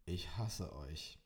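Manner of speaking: slow, low, sulky grumble; grumpy declaration. A narrator's reading. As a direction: announce it like a sulking child. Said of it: "verkündete ich krummelig".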